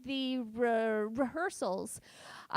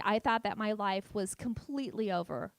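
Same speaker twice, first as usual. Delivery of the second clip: ordinary talk, nothing marked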